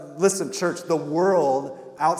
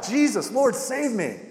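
higher-pitched plea, only half cried out; retold desperate cry; 7 semitones higher than usual. Preacher voicing Peter as he sinks, retold cry. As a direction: retell a sinking man's call for help. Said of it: "he cried out".

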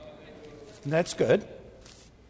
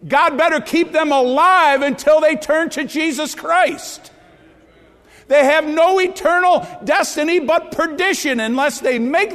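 louder, higher preacher's warning, near a shout; preaching at full force; 6 semitones higher, 4 dB louder than usual. Acted. Preacher warning parents, near a shout. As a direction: drive it home like a warning.

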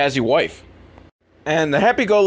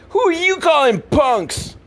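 high, put-on tough teen; a bratty teen voice, pitched way up; mock punk voice; 13 semitones higher than usual. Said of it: "they're like".

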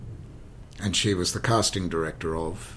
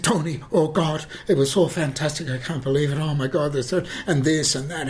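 high-pitched breathless-with-praise raving; gushing delight; 7 semitones higher, 3 dB louder than usual. Retold or imitated. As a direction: imitate an admirer bubbling over. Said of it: "he just loved it".

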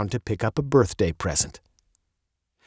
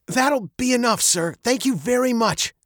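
higher voice, politely cutting him off; insincere thanks; 10 semitones higher than usual. A narrator's reading. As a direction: thank him without meaning it. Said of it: "not meaning it at all".

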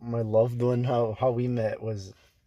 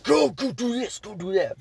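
high mock bossing orders; hammed-up demanding boss; bossy manager; 10 semitones higher than usual. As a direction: mimic the manager barking tasks, pitched way up. Manager bossing staff around.

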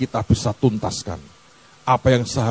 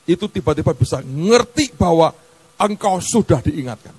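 usual speaking voice

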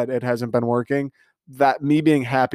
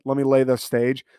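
ordinary talk, nothing marked

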